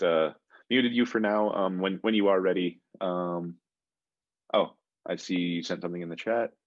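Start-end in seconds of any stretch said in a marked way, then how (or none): none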